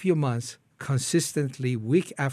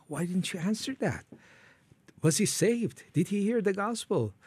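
higher-pitched, anguished questioning; retold crisis prayer; 7 semitones higher than usual. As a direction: question God, pitch raised, without shouting. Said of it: "I went to crisis".

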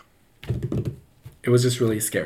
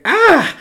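very high, loud short shriek; imitated child's startled yelp; startled scream; 8 dB louder than usual. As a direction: shriek in mock shock. Acts child's shock at talking doll.

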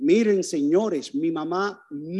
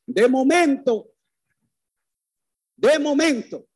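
de momento said louder and higher; dramatic repetition; 5 semitones higher, 6 dB louder than usual. Real, not acted. Preacher building suspense, louder repeated phrase.

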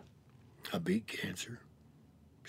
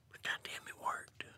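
whispered; 10 dB quieter than usual. Real, not acted. Telling how recovery finally worked, in a hushed whisper.

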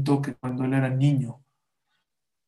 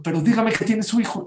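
higher, louder anxious question; concerned pharmacist; 8 semitones higher, 4 dB louder than usual. Acted. Pharmacist asks the crying mother about her son, higher anxious voice. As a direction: press her gently but urgently.

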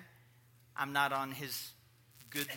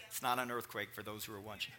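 laughing aside; 11 semitones lower, 7 dB quieter, faster than usual. Real, not acted.

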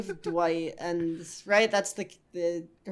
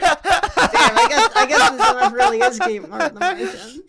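high, loud squeaky cartoon voice; 11 semitones higher, 11 dB louder than usual. Mocking the bad impression.

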